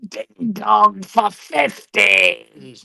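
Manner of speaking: slow possessed growl; Exorcist demon voice. Acted. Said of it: "in the Ashton voice".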